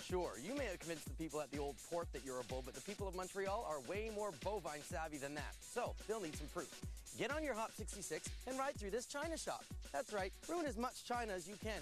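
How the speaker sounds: normal talking voice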